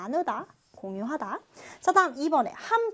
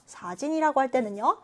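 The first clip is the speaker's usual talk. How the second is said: ordinary talk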